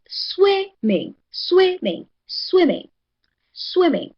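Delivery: "swimming" with the first syllable pitched high, then falling; stress on SWIM. Shown as a demonstration. Teacher models stressed syllable to class, high-pitched first syllable of "swimming". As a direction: lift SWIM high and drop on "ming" in "swimming". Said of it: "receives the higher voice".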